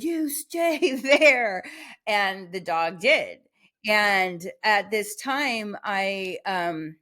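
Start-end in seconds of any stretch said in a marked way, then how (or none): 0.0-1.6 higher, louder, slow order breaking into a laugh; slow, firm dog command with a laugh; pack leader command with a laugh. Retold or imitated. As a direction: command the dog, chuckling mid-line. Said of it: "pack leader".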